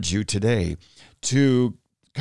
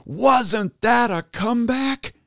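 much higher, slow, excited imitation of his wife; 11 semitones higher, slower than usual. Wife bursting in thrilled about the comeback, much higher, excited. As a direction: chirp it like she's thrilled at the comeback. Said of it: "she's all excited".

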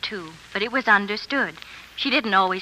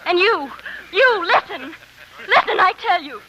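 excited; 6 semitones higher, 7 dB louder than usual. Acted. Eager actress demanding attention.

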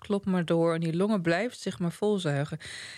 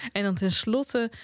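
usual speaking voice

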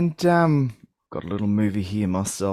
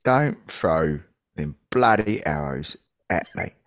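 lower, gruff London film star voice; Michael Caine impression; 8 semitones lower than usual. Reviewer answering a request with a mock Michael Caine line. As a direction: growl it like Michael Caine in a caper. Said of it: "in Michael Caine's voice".

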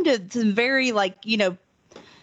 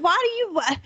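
incredulous; 10 semitones higher than usual. Retold or imitated. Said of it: "I'm just like".

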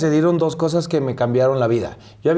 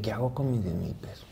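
hushed whispered inner question; 4 semitones lower, 12 dB quieter than usual. Retold or imitated. Broke actor wondering what to do with his money, whispered. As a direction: whisper it to yourself, a bit lost. Retold worried thought, whispered.

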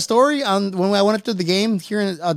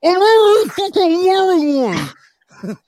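much higher, louder, slow incredulous snap; exasperated retort; 10 semitones higher, 4 dB louder, slower than usual. Retold or imitated. Telling the guard he has a mask on, exasperated. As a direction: disbelief that he's asking at all. Retelling arguing with security guard.

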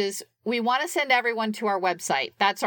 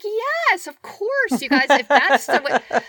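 excited coach; 5 semitones higher, 6 dB louder, slower than usual. Retold or imitated. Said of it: "He's like".

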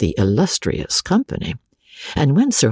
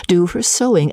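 plain reading voice

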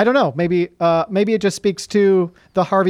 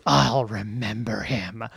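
lower, softer, slow mock dramatic line; hammed-up vow; 6 semitones lower, 6 dB quieter, slower than usual. Imitating a bad actor's brooding farewell at the border. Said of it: "badly acted moment".

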